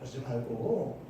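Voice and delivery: ordinary delivery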